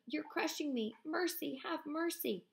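high, pleading cry under the crush; desperate cry. Acted. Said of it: "the same faint voice".

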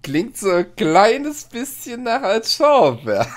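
slow, high, laughing through the words. Real, not acted. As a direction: giggle it out slowly. Repeats friend's joke laughing.

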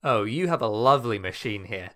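loud, mock-sweet menace; smiling threat. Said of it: "Not convincing enough?".

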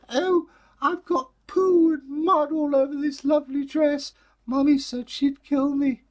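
put-on high, loud tearful girl voice; crying little girl. Girl tells kindly neighbour about her dress, crying high. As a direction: sob out the trouble like a small child.

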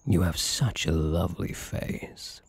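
usual speaking voice

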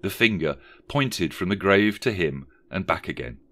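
ordinary delivery, nothing marked

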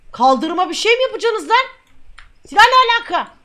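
high, loud incredulous outburst; outraged shouting. Pretend. Officer confronting smuggler over a weapon, outraged shout.

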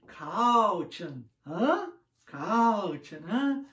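"Caution" as "cau-tchan", Portuguese sounds, questioning "hã?"; Portuguese-style pronunciation. Retold or imitated. Learner repeating his misread warning to the girl at the gym. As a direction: say "Caution" the Portuguese way, nudging for a reply. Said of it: "C A U é pronunciado cal e T I O N é pronunciado tion".

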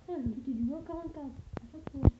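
ordinary talk, nothing marked

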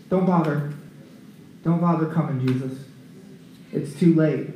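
low-key, softened delivery; subdued bad news. Messenger telling Jairus his daughter is gone, subdued.

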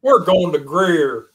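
slow, drawn-out Southern take on Greer; Southern accent. Retold or imitated. Host teases the guest's Southern pronunciation, slow Southern accent. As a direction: stretch Greer out in a teasing Southern drawl. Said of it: "picking up a Southern accent there".

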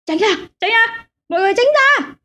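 high frantic shouts to clear the road; panicked cart driver shouting high; desperate yelling. Acted. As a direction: yell for everyone to get out of the way. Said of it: "Gã chỉ biết la hét trong vô vọng".

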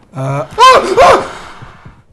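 high startled screams. Real, not acted. Gamer startled by a jump scare, high screams.

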